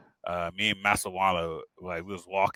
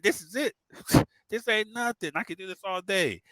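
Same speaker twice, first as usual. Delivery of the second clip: cocky boast; 9 semitones higher than usual. Retold or imitated. Cocky boast to Ranger instructor.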